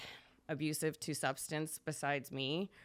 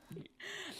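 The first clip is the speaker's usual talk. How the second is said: teary laugh; 13 semitones higher, 12 dB quieter than usual. Real, not acted. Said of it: "you're gonna make me cry".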